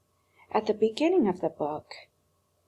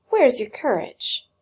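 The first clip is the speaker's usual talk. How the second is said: a sharp, louder challenge; mock-stern old doll, higher and louder; scolding; 5 semitones higher, 6 dB louder than usual. A narrator's reading. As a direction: prod him, scornful.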